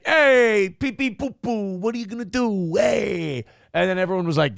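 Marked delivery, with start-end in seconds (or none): 0.1-3.5 high, hammed-up Italian guy; squeaky mocking impression; mock Scaramucci voice. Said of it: "And he went".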